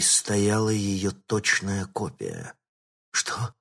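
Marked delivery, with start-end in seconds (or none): 3.1-3.5 breathless terror. Acted. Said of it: "задыхаясь от ужаса, пролепетала".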